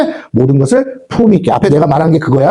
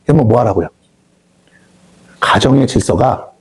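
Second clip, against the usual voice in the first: usual speaking voice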